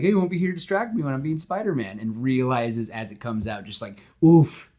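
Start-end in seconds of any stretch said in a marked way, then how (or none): 0.0-2.0 joking. Retold or imitated. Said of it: "just that joking". 4.2-4.7 sharp high loud oof of regret; a loud pained wince at his own words; loud wincing oof. Retold or imitated. Peter winces loudly at his own bad joke.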